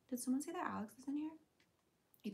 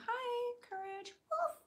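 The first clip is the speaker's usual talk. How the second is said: pet talk; 14 semitones higher, slower than usual. Real, not acted. Greeting a viewer's dog.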